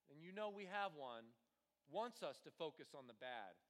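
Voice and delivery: usual speaking voice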